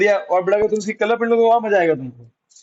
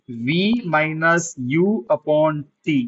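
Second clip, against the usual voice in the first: slow dictation; 2 semitones lower, much slower than usual. Shown as a demonstration. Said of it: "teacher dictating formula".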